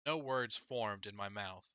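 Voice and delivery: ordinary delivery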